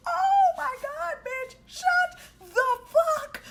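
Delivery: high, loud panicked shriek; a high shouted cry of alarm; mortified shriek. Retold or imitated. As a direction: shriek in horror at a friend spilling secrets. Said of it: "I was like".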